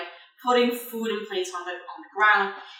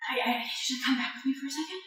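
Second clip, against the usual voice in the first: urgent aside; 3 semitones higher, 8 dB quieter, much faster than usual. Acted. Faint Gwen asks for help.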